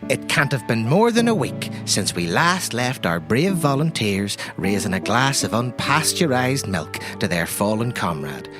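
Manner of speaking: normal talking voice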